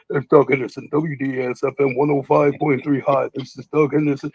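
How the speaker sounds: low, soft radio DJ intro; a lightly voiced late-night DJ sign-on; imitating Doug Henderson. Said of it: "I was like".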